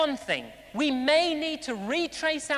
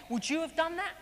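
ordinary delivery

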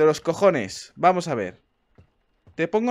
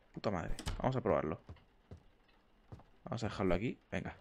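ordinary talk, nothing marked

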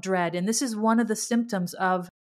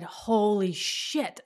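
slow, drawn-out stunned realization; stunned exclamation; much slower than usual. Retold or imitated. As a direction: let it dawn on you slowly. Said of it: "out loud".

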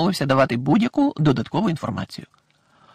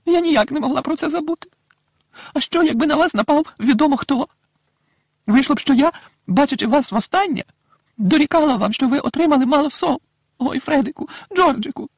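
high, worried motherly voice; a fretting mother, breathless with worry; anxious mother; 13 semitones higher, 4 dB louder than usual. A narrator's reading. Mrs Weasley runs to her husband, high and anxious.

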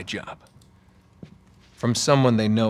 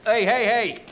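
yelling; 7 semitones higher, slower than usual. Acted. Yelling at the guard.

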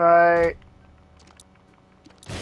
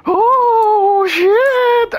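high, loud drawn-out wail; hammed-up wailing impersonation; Paul Bearer; 7 dB louder than usual. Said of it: "That was my Paul Bear, uh, impersonation".

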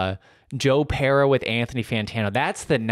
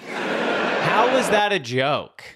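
slow, higher, laughing; laughing disbelief; 6 semitones higher, slower than usual. Real, not acted. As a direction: ask it incredulously through laughter.

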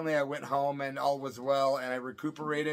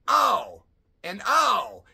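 pained ow; 7 semitones higher, 9 dB louder, much slower than usual. Retold or imitated. Swarmed by stinging hornets.

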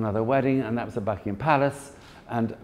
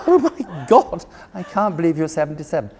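high, loud laughing cry of amazement; laughing in astonishment; laughing disbelief; 8 semitones higher, 5 dB louder than usual. Retold or imitated. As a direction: gasp it through laughter.

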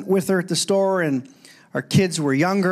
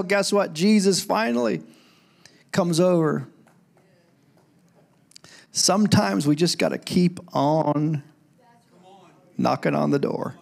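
usual speaking voice

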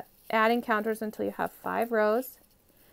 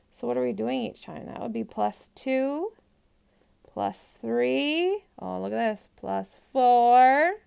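ordinary talk, nothing marked

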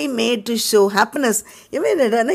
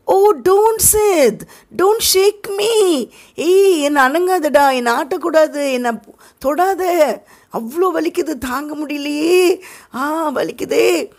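fake moaning; 7 semitones higher, slower than usual. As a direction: moan like it hurts too much to bear. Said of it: "But now he is acting". (போலியாக வலியில் முனகும் உயர்ந்த குரல்)

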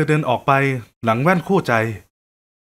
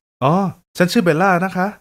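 higher, gentle female voice; kind young woman; 3 semitones higher than usual. Acted. Woman at the buffet offering help, higher gentle voice.

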